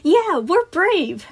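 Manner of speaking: put-on high, loud, drawn-out child voice; agreeing little girl. A narrator's reading. Girl backing up her friend, proud chime-in. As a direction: chime in proudly like a small kid. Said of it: "Emily nodded in agreement".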